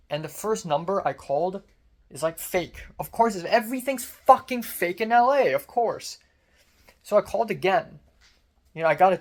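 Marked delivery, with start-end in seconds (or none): 3.0-6.2 loud, exasperated outburst; exasperated rant. Real, not acted. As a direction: vent it hard and loud. Ranting about fake numbers.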